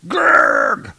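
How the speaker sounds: loud, low effort grunt; straining growl. Acted. Dark elf pinned under enchanted hammer, straining growl. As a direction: growl as if heaving at a weight.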